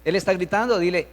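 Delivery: usual speaking voice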